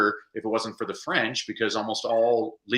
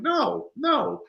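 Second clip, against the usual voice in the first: high, insistent "no, no" to parents; emphatic reassurance; 9 semitones higher, slower than usual. Retold or imitated. Teacher to parents ashamed of their Spanish, emphatic reassurance. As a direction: earnestly talk them out of shame, pitched up.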